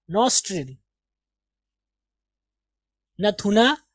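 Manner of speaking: ordinary talk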